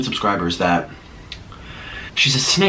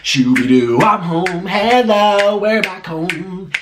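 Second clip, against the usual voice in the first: vlog intro voice; 6 semitones higher, much slower than usual. Acted. Sing-song vlog intro greeting.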